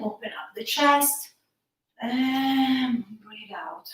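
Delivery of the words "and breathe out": slow whispered exhale; slow deep breaths. Shown as a demonstration. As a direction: breathe the words out slowly on air. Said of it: "We'll do a couple of these breathing".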